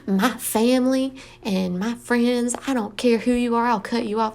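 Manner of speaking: hammed-up indignant rant about family; mock dramatic poster. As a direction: imitate someone making a big scene online. Mimics dramatic posters of online rants.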